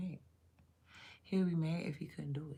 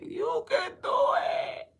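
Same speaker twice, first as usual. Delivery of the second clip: breathy high cheer; 7 dB louder, slower than usual. Retold or imitated. Reenacting cheering on his son.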